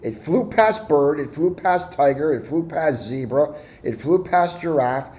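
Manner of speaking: ordinary delivery, nothing marked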